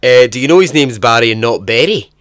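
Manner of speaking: put-on loud blunt Scottish accent; hammered-out Scottish bluntness; loud Scottish coworker correcting the name. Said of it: "typical Scottish, subtle as a brick".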